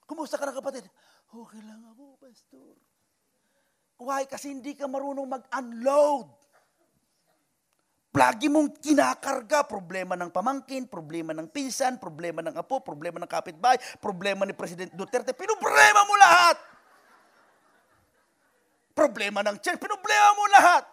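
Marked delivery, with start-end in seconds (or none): 1.3-2.8 quiet. Pretend. Tired member answering pastor. 15.3-16.6 pinoblema mo lahat shouted high and loud; preaching shout. Real, not acted. Pastor rebuking the congregation for worrying, loud preaching shout. 19.8-20.8 pinoblema mo lahat shouted high and loud; preaching shout. Real, not acted. Pastor rebuking the congregation for worrying, loud preaching shout.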